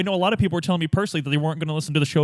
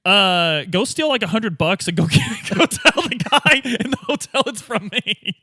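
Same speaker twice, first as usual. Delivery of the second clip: higher, brash, laughing a bit, lightly voiced detective; slapping the kid on the back, chummy and higher; cocky street-kid pal; 5 semitones higher than usual. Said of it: "I'm, uh, like".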